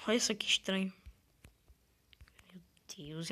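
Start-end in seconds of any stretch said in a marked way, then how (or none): 2.5-3.3 whispering. Real, not acted. Awed at the island.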